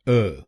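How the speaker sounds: "अ" as a short neutral uh; just a light "अ", short uh; third syllable demo. Shown as a demonstration. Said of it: "बोलिए अ".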